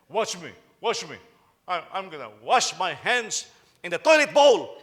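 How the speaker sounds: put-on loud, eager kid voice calling for attention; showing-off kid. Preacher voicing a kid who shows off for attention, loud. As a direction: play a kid begging to be watched. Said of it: "Some kids will say".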